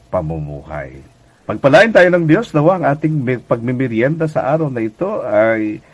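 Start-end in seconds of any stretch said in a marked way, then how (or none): 1.4-4.8 blessing. Real, not acted. Closing blessing to listeners.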